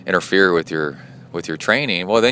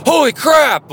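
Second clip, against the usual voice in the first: sudden high shouted alarm; loud startled cry of a woken wife; startled yell; 6 dB louder than usual. Retold or imitated. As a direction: yell it out, jolted awake. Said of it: "like that".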